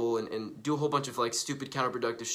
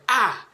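high, loud "ah" as if stung; startled yelp at an insult; mock wounded cry; 9 semitones higher, 7 dB louder than usual. Insulted passerby reacts, mock pained yelp. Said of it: "you're just like".

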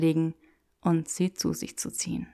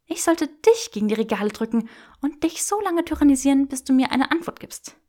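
higher and louder teasing menace; playful threat; 7 semitones higher, 4 dB louder than usual. Acted. Granger threatening to press Draco against shelves, playful. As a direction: threaten him with a grin.

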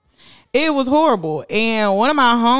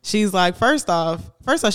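ordinary delivery

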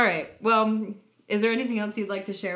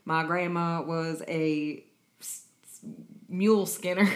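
loud, hammed-up Southern drawl, slow in places; practiced Southern accent; 5 dB louder than usual. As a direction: play a mule-skinner's grandkid from Louisiana. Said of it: "I have to practice".

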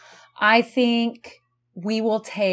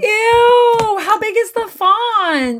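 shocked squeal; 8 dB louder, much slower than usual. Real, not acted. Shocked at book length.

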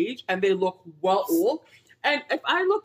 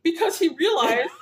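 laughing; 9 semitones higher, 4 dB louder, slower than usual. Real, not acted. Laughing while retelling story.

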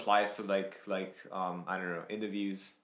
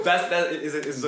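words broken by laughter, higher and louder; laughing; 6 semitones higher, 7 dB louder than usual. Real, not acted. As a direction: laugh your way into the sentence. Laughing along with friend.

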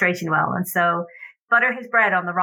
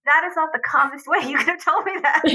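voiced child; 7 semitones higher than usual. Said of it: "You say".

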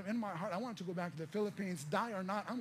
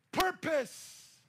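Purpose. punched higher and louder as a headline word; emphatic keyword; 7 semitones higher, 8 dB louder than usual. Real, not acted. Giving the congregation the key word, emphatic. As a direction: land Purpose. like a banner. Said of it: "Write this down".

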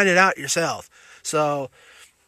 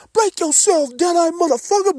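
Ash tough guy; 13 semitones higher, 4 dB louder than usual. Pretend. Ash robbing Deadites, imagined.

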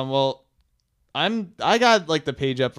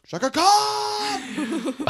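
slow, dramatic, laughing Shatner; Shatner impression; much slower than usual. Host does Shatner reading the birthday list. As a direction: stretch the name out, far higher. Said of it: "That's my Shatner".

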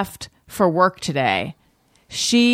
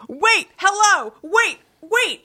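Wendy; 10 semitones higher, much slower than usual. Retold or imitated. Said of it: "Yeah, hers like".

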